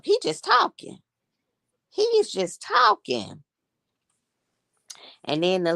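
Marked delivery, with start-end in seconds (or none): 0.0-3.4 exasperated disbelief. Retold or imitated. Telling viewers he won't shut up, high exasperated whine. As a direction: whine it like you're done with him. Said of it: "I said".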